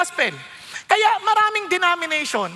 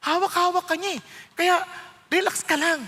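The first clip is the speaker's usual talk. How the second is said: ordinary delivery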